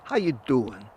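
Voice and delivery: lightly voiced New York mobster accent; a hint of a tough-guy New York gangster; New York gangster impression. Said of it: "that's my gangster impression".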